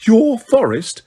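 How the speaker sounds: indignant but terrified disbelief; shaking with fright and indignation. A narrator's reading. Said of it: "shaking now, not only with fright".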